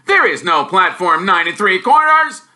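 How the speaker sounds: blustering uncle scolding Harry. Acted. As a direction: snap the boy down.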